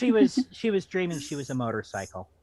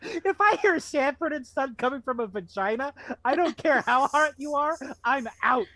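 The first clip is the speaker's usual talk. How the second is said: high, louder mock-outraged rant; jokey outrage; 11 semitones higher, 4 dB louder than usual. Real, not acted. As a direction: protest loudly, half laughing. Joking outrage over the story.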